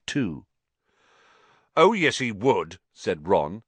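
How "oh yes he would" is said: louder, higher retort; loud contradiction. A narrator's reading. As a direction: contradict firmly, voice raised. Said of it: "even more loudly than Dean".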